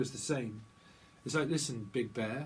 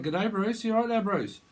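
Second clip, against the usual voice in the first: mock Aussie greeting; 7 semitones higher, 6 dB louder than usual.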